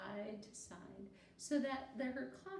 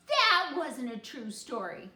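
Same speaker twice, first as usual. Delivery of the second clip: put-on loud indignant child's call; boy calling out in protest; 3 semitones higher, 13 dB louder than usual. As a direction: blurt it out across the classroom. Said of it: "Barry called out".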